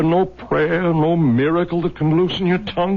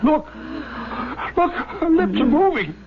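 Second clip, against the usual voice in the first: much higher, urgent and amazed; excited; 9 semitones higher than usual. Acted. Husband seeing his dying wife stir, excited. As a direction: gasp it out as hope returns.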